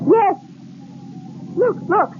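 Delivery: high bright exclamation; wife spots smoke from the chimney; excited. Acted. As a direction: burst out with relief.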